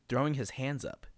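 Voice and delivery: usual speaking voice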